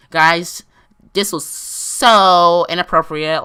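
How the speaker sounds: loud, slow, stretched-out "so"; hammed-up stern disapproval; scolding lecturer. Imitating school staff lecturing the fourth grade, loud scolding.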